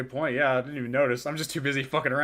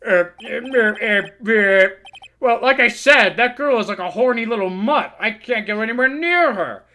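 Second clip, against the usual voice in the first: lightly voiced old lawyer, louder and worked up; a hint of a rattled old man ranting; crude old lawyer; 5 semitones higher, 8 dB louder than usual. Old lawyer ranting about the girl, mildly worked up.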